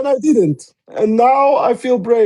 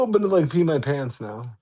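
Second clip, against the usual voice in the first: ordinary talk, nothing marked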